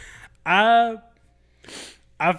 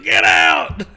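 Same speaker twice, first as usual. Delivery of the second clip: gruff shout; 12 semitones lower, 7 dB louder than usual. Retold or imitated. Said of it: "he's like".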